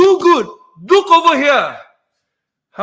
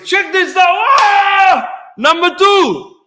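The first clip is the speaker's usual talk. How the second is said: higher, louder hype with a shouted "Ah!"; excited hype; 7 semitones higher, 3 dB louder, slower than usual. Real, not acted. Host hyping viewers for pick number two, excited. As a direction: pump up the crowd for the next pick.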